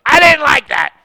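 loud, high angry shout; really mad. Acted. Preacher acting someone really mad, furious shout. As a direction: blow up at full volume in mock fury. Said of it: "if we're really mad".

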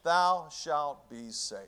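slowed, every word weighted; preacher answering his own question, slowly; slow emphasis. Acted. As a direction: lay out each word slowly.

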